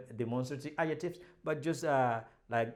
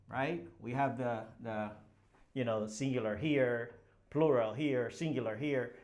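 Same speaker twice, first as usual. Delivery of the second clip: ordinary delivery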